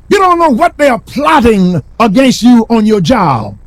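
preacher driving the point home; full preaching. Acted. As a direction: press each line harder.

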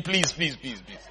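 soft, fading mock pleas; retold begging. Scared friends begging him, hushed mock pleading. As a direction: plead meekly, trailing away almost to nothing. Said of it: "we started begging him".

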